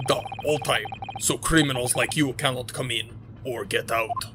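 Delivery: put-on gruff Russian-accented guard, proud; guard showing off the first-class cabins, Russian accent. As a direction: boast like a self-important Russian guard. Sailor voice.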